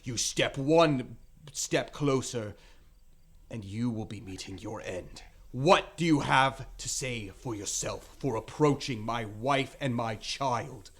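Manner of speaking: father's forceful, measured threat; threatening challenge. Acted. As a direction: menace a stranger to protect the family. Grim man threatens stranger with dagger.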